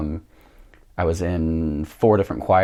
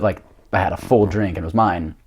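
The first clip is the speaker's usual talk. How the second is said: normal talking voice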